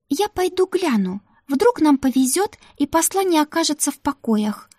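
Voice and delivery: put-on high eager young maid; Ruta's voice. Ruta vouching to the madam for the new maid, high and eager. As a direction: pitch it up, pleading for the new girl.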